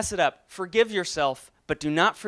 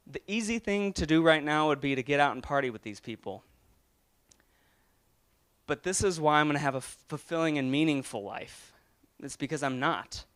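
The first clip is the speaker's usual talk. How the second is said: getting emotional; 6 dB quieter than usual. Real, not acted. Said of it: "I don't know why I'm getting emotional".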